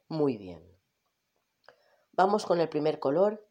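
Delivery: ordinary talk, nothing marked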